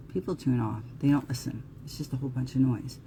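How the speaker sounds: ordinary talk, nothing marked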